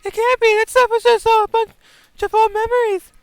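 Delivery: loud, high denial; distressed shout. Acted. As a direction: cry out refusing it.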